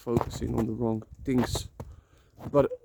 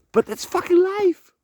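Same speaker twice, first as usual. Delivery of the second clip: defiant outburst; 7 dB louder than usual. Real, not acted. Defiant outburst about painful truth.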